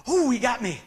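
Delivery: a loud laugh at being tricked; caught out, laughing. Retold or imitated.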